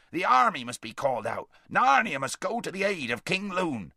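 rally them like a brisk dwarf; urgent dwarf. A narrator's reading.

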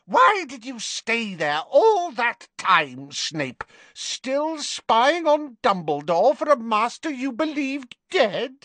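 high, slow accusing taunt; accusing witch. Acted. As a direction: demand it with scorn. Bellatrix accusing.

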